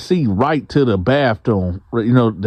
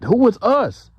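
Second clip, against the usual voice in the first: baffled question; 10 semitones higher than usual. Retold or imitated.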